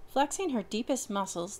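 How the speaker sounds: ordinary delivery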